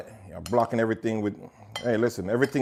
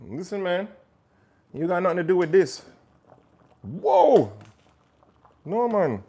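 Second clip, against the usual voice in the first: put-on Jamaican accent; 6 semitones higher than usual. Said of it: "This part is not Jamaican".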